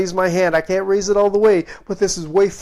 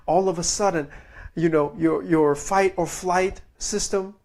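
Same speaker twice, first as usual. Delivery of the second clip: ordinary delivery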